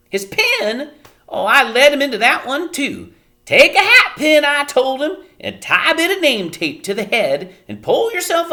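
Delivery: loud high self-reproach; Homily fretting. A narrator's reading. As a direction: blame yourself loudly, high. Homily blaming herself.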